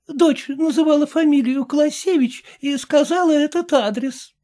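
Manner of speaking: put-on a frail old woman explaining patiently; lightly aged lady, unhurried. A narrator's reading.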